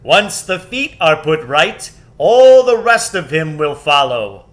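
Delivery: loud booming lion voice; joyous shout. Acted. Aslan reassuring Susan about the giant, booming. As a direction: call out gladly to all. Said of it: "shouted Aslan joyously".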